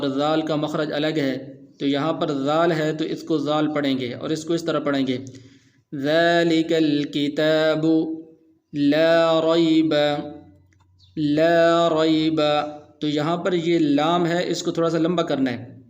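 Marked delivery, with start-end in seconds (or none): 5.9-12.4 slow chant, a little louder; Quran recitation. Shown as a demonstration. Teacher modelling correct ذال for students in slow chant. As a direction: intone the verse slowly for students to copy.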